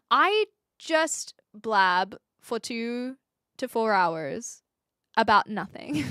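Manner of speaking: spaced out with long pauses; slow, deliberate answer. Acted. Streamer answers what the stream is about, slowly and deliberately. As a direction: draw each phrase out slowly, mock-considered. Acted answer to curious people.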